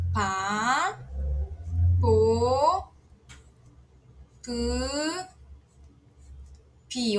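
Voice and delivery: "Pá, pô, pu, pi" with a pause after each; Korean syllables read aloud. Shown as a demonstration. Teacher modeling Korean syllables for learners, spaced out. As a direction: model "Pá, pô, pu, pi" slowly and clearly. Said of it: "Observem bem a ordem de escrita".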